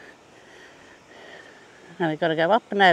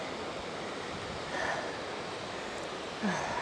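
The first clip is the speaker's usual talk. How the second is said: long sigh; 2 semitones lower, 17 dB quieter than usual. Real, not acted. Relief sigh nearly at bottom.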